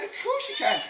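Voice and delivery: high-pitched mum voice. Acted. Mum letting her little boy go, high-pitched. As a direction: answer in a high motherly voice. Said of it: "And she said".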